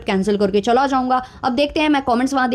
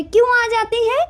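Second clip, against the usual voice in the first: much higher, whiny mock question; mocking the husband's voice-over; 10 semitones higher than usual. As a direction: parody his silly question. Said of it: "उस पर voice over चल रहा है". (पति की आवाज़ की नकल, ऊँची चिढ़ाती आवाज़)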